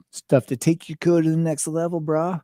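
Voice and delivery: tossed-off surfer-bro twang; hipster voice. Pretend. Echoes the hipster ad voice.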